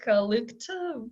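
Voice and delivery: normal talking voice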